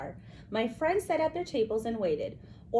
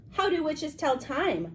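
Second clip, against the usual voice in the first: odd, much higher voice; strange high voice; 8 semitones higher than usual. Acted. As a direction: ask it in a weird squeaky voice. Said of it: "in a strange, high-pitched voice".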